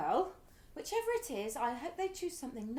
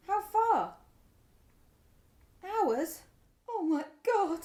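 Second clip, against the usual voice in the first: louder, higher, breaking into alarm; rising panic; 4 semitones higher, 7 dB louder, slower than usual. Acted. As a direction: she realises they cannot reach her daughter. Mother learns road is blocked.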